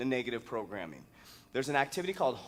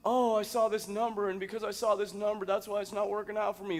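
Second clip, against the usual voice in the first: higher-pitched put-on excuse-making; mocking high voice of superstitious worry; 6 semitones higher than usual. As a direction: imitate a superstitious complainer, pitched up. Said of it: "you start to get superstitious".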